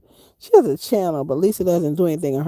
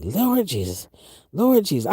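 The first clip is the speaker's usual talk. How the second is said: grandmother praying. Retold or imitated. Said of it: "my grandmother used to say that".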